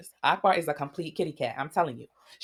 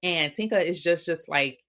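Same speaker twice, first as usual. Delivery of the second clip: ordinary talk, nothing marked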